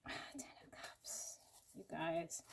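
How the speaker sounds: barely voiced, slow and hushed; awed whisper. Real, not acted. To her viewers, a stunned whisper. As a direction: breathe the card name in a slow whisper. Overwhelmed while turning tarot cards.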